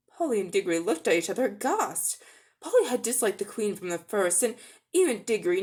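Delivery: usual speaking voice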